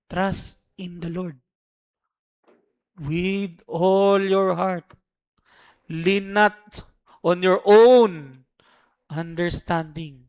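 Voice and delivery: very slow, phrase by phrase; lingering verse reading; slow emphatic. Shown as a demonstration. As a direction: weigh each word. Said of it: "slow re-reading".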